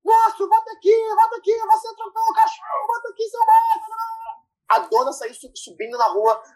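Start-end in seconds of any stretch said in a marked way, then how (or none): 0.0-4.3 high-pitched mock yell after a fleeing car; mock yelling girl. The girl screaming at the driver who ran over the dog, high mock yell.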